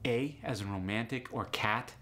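ordinary talk, nothing marked

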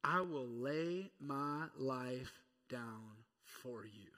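slow, earnest promise; hushed, slow pledge; solemn vow. Acted. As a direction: lay it down slowly and sincerely. Peter's vow, said slowly.

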